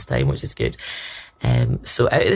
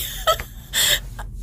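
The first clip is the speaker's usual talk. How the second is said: soft breathy laugh through tears; tearful laugh; 7 dB quieter than usual. Real, not acted. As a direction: laugh while still crying. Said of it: "So I know I am crying".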